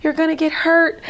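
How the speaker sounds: re-enacted frantic yell; retold screaming. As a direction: yell a warning, partly held back. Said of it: "I'm screaming, I'm yelling".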